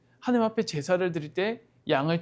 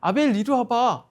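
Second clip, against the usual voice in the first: higher, lightly acted call to come; calling a brother over; 5 semitones higher, 3 dB louder than usual. Cain calls his brother Abel over, acted beckoning call. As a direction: call out to a younger brother, pitched up. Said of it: "가인은 아벨을 불렀습니다".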